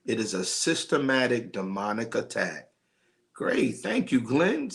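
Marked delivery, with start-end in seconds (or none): 3.3-4.7 much higher, bright burst of Great then Thank you, Glenn; thrilled thank-you to Glenn for subscribing; delighted thanks. Real, not acted. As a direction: jump up with real delight at the news. Viewer just subscribed, thanking him.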